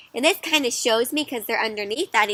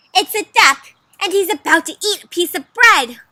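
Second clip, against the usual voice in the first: high, loud storybook argument; animated, raised-pitch declaration; excited insistence; 7 semitones higher, 10 dB louder than usual. Acted. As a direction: make your case with big enthusiasm. Duck believer makes a case.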